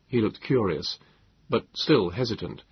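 usual speaking voice

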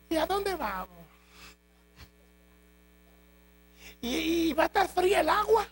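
high, pleading questions of a nervous new recruit; whiny recruit. Acted. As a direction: pester the captain like an anxious rookie. Recruit pestering the captain with questions.

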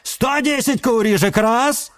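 high auctioneer count; auctioneer call. A narrator's reading.

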